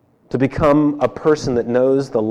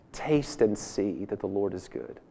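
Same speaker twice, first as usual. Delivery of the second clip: hushed scripture quote; 7 semitones lower, 14 dB quieter than usual. Acted. Quoting David, hushed and tender.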